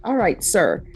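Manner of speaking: usual speaking voice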